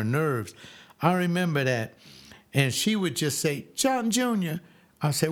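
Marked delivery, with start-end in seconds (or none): 3.7-4.6 put-on high calling out of the boy's name; mother calling. Mother summoning her son, a high call. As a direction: call him like his mother from the house.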